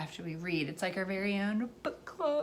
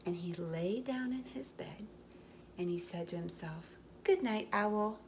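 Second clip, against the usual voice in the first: gentle storybook read-aloud; reading to children; 4 dB quieter than usual. A narrator's reading. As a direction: tell it like a bedtime story for little ones. Reading picture book aloud.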